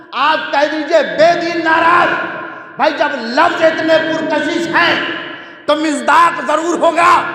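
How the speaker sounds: high, loud impassioned preaching; preaching at full force. Real, not acted. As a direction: build it to a ringing climax. Impassioned climax of the majlis. (پرجوش بلند آواز میں خطابت)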